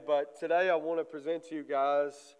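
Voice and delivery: normal talking voice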